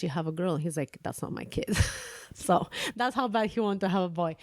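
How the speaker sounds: ordinary talk, nothing marked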